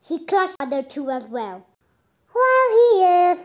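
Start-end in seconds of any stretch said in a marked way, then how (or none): 2.3-3.4 with a sigh. A narrator's reading. Captured boy complaining to the others, sighing. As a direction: heave it out as a big complaint. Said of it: "said Dick with a sigh".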